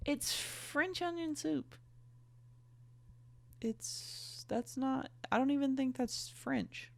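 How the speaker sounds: high, soft, hesitant; small doubtful voice. Real, not acted. Reader doubting the soup is French, high and quiet. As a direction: shrink to a tiny, high mumble.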